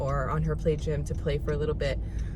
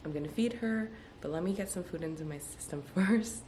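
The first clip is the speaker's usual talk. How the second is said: ordinary delivery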